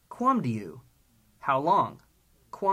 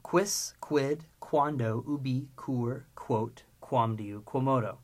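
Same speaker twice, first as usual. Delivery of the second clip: deliberate list with gaps between words; slow recitation; slower than usual. Shown as a demonstration. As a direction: chant the list slowly, word by word.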